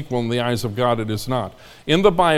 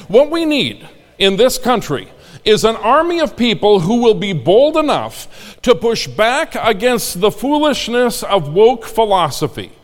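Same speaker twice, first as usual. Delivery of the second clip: rousing sermon, building higher; full-force preaching crescendo; roaring rallying speech; 6 semitones higher, 3 dB louder than usual. Acted.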